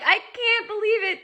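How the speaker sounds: very high-pitched amazement; retold disbelief. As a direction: relive being stunned by good news. Retells disbelief at kitten's recovery.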